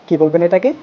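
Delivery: ordinary delivery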